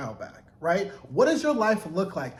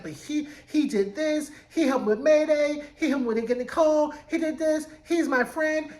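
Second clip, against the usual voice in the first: mock pleading voice, higher pitch; imitating June pleading; 8 semitones higher than usual. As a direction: plead for him like an earnest June. June pleads for Lawrence to Luke.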